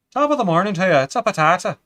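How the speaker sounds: high, loud, put-on Irish accent; rough Irish accent. Shown as a demonstration. Streamer shows off his Irish accent, cheerfully bad and loud. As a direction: belt out a not very good Irish accent. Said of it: "I can sorta do an Irish accent".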